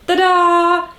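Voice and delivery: playful ta-da. Acted. Reveal in explanation.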